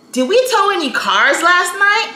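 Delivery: high-pitched, rising polite question; office lady asking. Retold or imitated. Office lady asking Bud about towed cars, mildly inquiring. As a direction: voice the clerk checking with a colleague.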